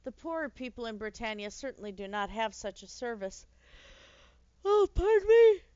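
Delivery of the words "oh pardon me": loud, high yawned apology; yawning. Acted. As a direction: stretch into a big yawn. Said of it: "Pause, yawn".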